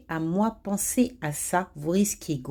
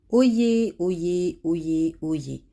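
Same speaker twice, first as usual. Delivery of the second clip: town crier; 3 semitones lower, much slower than usual. Acted. Opens a warning to colleagues.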